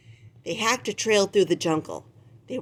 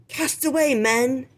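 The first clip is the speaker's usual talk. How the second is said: much higher barked order; pirate command; 6 semitones higher, 3 dB louder than usual. Acted. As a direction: order the crew to cast off. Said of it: "Long John shouted".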